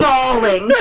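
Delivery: says it through a laugh; rueful laugh on the word. Real, not acted.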